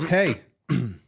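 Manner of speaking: half-hearted retold holler; retold yell. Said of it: "And he yells, he goes".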